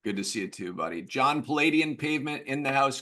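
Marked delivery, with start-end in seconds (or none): 1.0-3.0 higher, louder hype-man intro; hype introduction. Real, not acted. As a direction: announce him like he's arriving on stage. Host hypes a guest's arrival.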